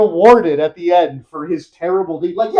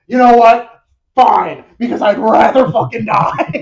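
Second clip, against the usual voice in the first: loud, exasperated outburst; blowing up; 3 semitones higher, 9 dB louder than usual. Retold or imitated. Knight blowing up at the old woman, loud angry outburst. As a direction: explode at her, much louder. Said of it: "he blows up on her".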